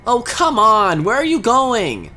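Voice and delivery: usual speaking voice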